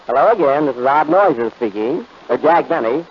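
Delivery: normal talking voice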